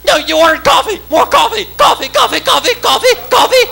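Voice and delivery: high shrieked waitress voice; insistent waitress. Acted. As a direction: shriek the order back, relentlessly high. Waitress insists he ordered coffee.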